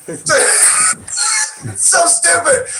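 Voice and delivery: drawn out through real laughter; laughing. Real, not acted. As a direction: squeeze the words out while cracking up. Laughing at own bee story.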